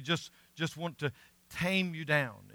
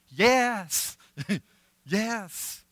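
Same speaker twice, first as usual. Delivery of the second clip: high eager inner yes with a laugh; 6 semitones higher, 5 dB louder than usual. Retold or imitated. In the back of the bus, a laughing eager yes. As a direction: cheer it inside, grinning.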